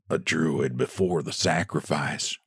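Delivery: soft, hushed murmur; frightened men murmuring their guesses. A narrator's reading. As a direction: murmur it fearfully under the breath. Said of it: "murmured another".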